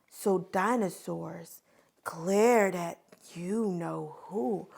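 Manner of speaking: quiet, slow, low menace; ominous hush. Shown as a demonstration. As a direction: draw it out softly. Ominous story moment.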